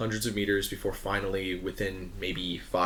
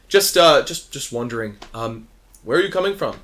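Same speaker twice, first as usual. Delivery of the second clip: raised and slightly awkward; a lightly voiced guard, fumbling; 3 semitones higher, 9 dB louder than usual. Guard pressing the captain, raised and awkward. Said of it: "he goes".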